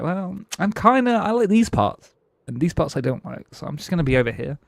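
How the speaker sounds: mocking fence-sitter. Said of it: "And everyone's like".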